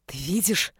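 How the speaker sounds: low, tense hiss; hissed reproach. A narrator's reading. Mother blaming husband for child's distress, hissing. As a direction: hiss it accusingly. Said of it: "прошипела Анита".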